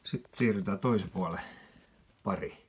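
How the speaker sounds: ordinary talk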